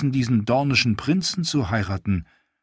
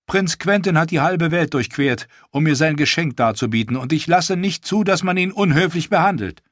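put-on higher, teasing young queen's voice; lightly higher regal queen, playful; queen Daenerys; 7 semitones higher, 3 dB louder than usual. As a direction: tease the prince, then turn to business.

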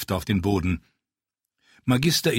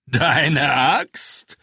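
big rider calls out in disbelief, louder; a mocking call from the big rider; 6 semitones higher, 5 dB louder than usual. A narrator's reading. Big rider scoffs at the dwarf's axe, calling out. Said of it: "rief der größere der Reiter".

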